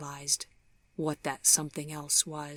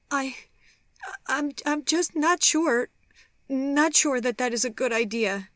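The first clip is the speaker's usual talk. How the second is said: very high, faltering, overwrought voice; anguished, halting reluctance; tearful refusal; 10 semitones higher, 4 dB louder than usual. A narrator's reading. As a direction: stammer it high, close to tears. She tearfully refuses to meet.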